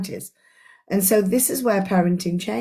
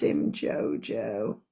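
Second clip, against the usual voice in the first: tender coo; 10 semitones lower, 8 dB quieter, much slower than usual. Real, not acted. Cooing tenderly at someone.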